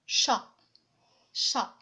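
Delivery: "Chat" as one short isolated beat; one single syllable. Shown as a demonstration. Teacher showing a one-syllable word, in a single beat. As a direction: say "chat" in one go, then pause. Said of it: "je le prononce une seule fois".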